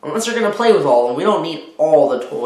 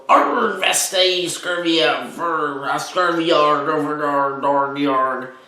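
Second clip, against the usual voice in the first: bellowing toy pirate; slower than usual. Acted. An egg-shaped toy pirate bellows at the mother, hammed-up. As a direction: play a silly toy pirate going arr. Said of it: "pirate that bellowed".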